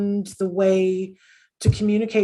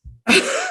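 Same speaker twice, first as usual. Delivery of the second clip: high laugh. Real, not acted. Laughing at son's remark.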